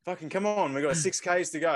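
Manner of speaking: high-pitched retold urging; imitating pumped-up runner. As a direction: act out egging a partner on. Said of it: "so he was pumped".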